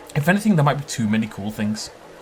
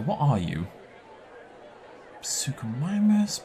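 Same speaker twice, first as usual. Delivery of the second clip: normal talking voice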